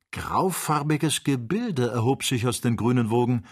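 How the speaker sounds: plain reading voice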